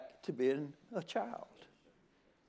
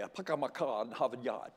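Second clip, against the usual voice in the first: put-on Yankee accent; 5 semitones lower, 7 dB quieter than usual. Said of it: "sometimes I talk like a Yankee".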